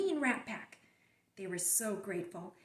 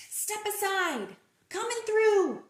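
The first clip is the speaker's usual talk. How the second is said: high loud call to clear the way; pushy call; 7 semitones higher, 8 dB louder than usual. Acted. Pigeon rushing to the bus, loud and pushy. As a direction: call it out as you barge past.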